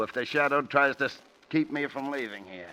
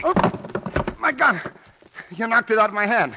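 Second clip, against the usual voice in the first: shocked cry; 9 semitones higher, 5 dB louder, faster than usual. Acted. Rocky disarmed by the Shadow.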